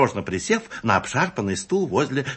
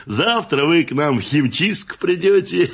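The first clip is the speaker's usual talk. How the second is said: higher, louder, jovial voice; hearty back-slapping confidence; chummy customer; 7 semitones higher, 4 dB louder than usual. A narrator's reading. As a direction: reassure an old pal heartily. Grinning customer reassures his accomplice.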